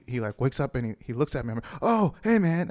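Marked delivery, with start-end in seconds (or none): 1.8-2.7 high, loud mock-casual greeting; feigned friendly surprise. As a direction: act pleasantly surprised, pitched way up. Greets man waking from choke-out.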